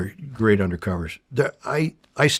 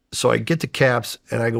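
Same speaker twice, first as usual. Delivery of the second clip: ordinary talk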